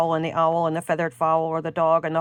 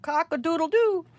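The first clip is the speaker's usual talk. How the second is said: high crowing rooster call; 9 semitones higher than usual. Acted. The flustered fowl crows, pitched high.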